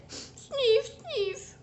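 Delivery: high, sad pretend sniffing; theatrical sniffs; mock sniffle. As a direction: sniff like a lonely child. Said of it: "fait tout à coup Alistair".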